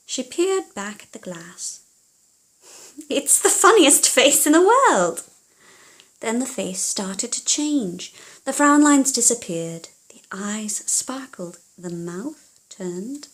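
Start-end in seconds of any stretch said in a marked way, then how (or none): 3.1-5.2 higher, louder, delighted exclamation; delighted princess. Acted. 10.1-13.3 soft whisper building suspense; a storyteller's hush; hushed narration. A narrator's reading.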